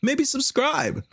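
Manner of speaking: ordinary talk, nothing marked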